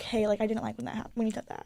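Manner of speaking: soft, tentative imitation of a guy; vulnerable admission. Guy telling a woman what upset him, vulnerable admission. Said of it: "usually it's more vulnerable".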